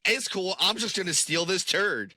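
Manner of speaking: high, breezy mock wrestler voice; mock casual dude voice. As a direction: toss it off like it's nothing. Voicing wrestler in mock quote.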